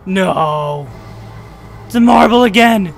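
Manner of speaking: loud shouts of alarm; alarmed shouting. Real, not acted. Marble enemy suddenly returns.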